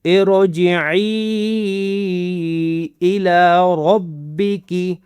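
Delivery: very slow ارجعي with a thick, full ر; slow recitation with an emphatic ر; heavy ra. Shown as a demonstration. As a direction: drag out ارجعي الى ربك with a heavy ر. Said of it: "رو کو موٹا بھی کرنا ہے".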